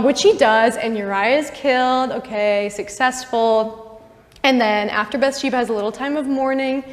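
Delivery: ordinary talk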